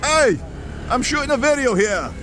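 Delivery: high-pitched calling out; mock-indignant call. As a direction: call out in playful protest.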